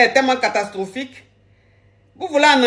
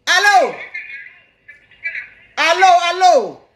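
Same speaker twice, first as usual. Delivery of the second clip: calling hello; 5 semitones higher than usual. Real, not acted. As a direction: call out to reach the caller on the line. Coach picks up a live call.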